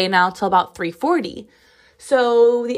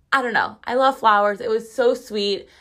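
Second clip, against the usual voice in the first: ordinary talk, nothing marked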